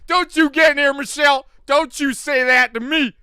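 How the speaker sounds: loud, high mock outrage. Mock-yelling at Michelle for joining in. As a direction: shout at Michelle in hammed-up fury.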